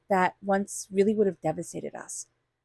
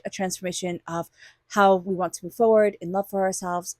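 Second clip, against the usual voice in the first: ordinary talk, nothing marked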